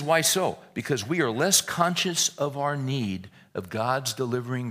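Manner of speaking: emphatic reading. Acted. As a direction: punch the question out. Reads [name] quote emphatically.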